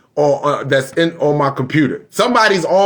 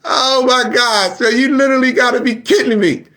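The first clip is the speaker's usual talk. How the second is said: real disbelief, pitched way up; amazed disbelief; 10 semitones higher than usual. Real, not acted. Streamer to viewers, high amazed disbelief. Streamer reacting to blocked computer.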